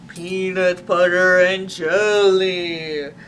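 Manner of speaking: slow, drawn-out zombie moan. Acted. A hungry zombie moans for the sandwich, slow and drawn out. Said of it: "he moaned".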